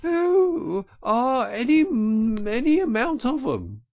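very high, loud wail; sobbing Toad as washerwoman. A narrator's reading. Toad invents hungry children for the driver, sobbing high. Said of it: "sobbed Toad".

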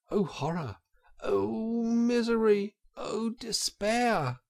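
high, drawn-out cry of despair; horrified wailing. A narrator's reading. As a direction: wail in dramatic dismay. Toad sees his pursuers coming.

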